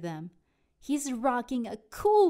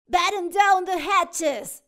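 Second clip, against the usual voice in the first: loud, higher command; captain's order; 8 semitones higher, 9 dB louder than usual. Acted. Captain orders the crew, loud command. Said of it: "says Captain Pete".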